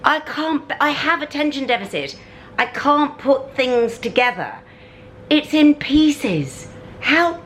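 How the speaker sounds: high, exasperated screech. Real, not acted. To viewers, fan came in pieces, high exasperated screech. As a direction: shriek in frustration at the flat-pack fan. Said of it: "I'm sorry I'm screeching".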